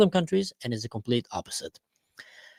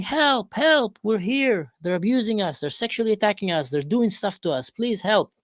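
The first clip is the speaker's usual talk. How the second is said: much higher mock cries for help; mock screaming children; 8 semitones higher than usual. Hidden children calling for rescue, higher mock screaming. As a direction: cry out from the basement, hammed-up. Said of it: "start screaming".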